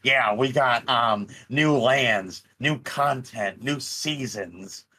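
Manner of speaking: mocking nasal voice, slow and drawn out. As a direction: drag it out through the nose like a bored dev. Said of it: "I'm gonna do the nasal voice again".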